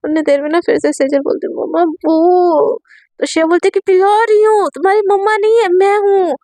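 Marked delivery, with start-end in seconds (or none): 1.5-2.8 drawn-out sick call. A narrator's reading. Sick girl calling mother. 3.9-6.3 irritated snap. Acted. Shreya snaps at patient.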